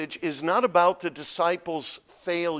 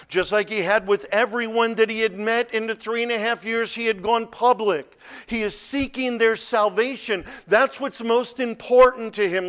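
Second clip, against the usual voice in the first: loud, high sermon delivery; full-force preaching; 8 semitones higher, 8 dB louder than usual. Real, not acted. Preacher urging the congregation on the gospel, full-force preaching. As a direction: drive it out to the whole congregation.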